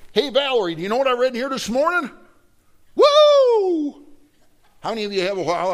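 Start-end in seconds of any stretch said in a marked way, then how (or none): 0.1-2.1 high, loud, fast; acted-out eager shout; excited calling out. Acts excited Bible reader calling out. 2.9-4.1 long, high, very loud whoop; hallelujah whoop. Acted. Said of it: "hallelujah fit when you read your Bible".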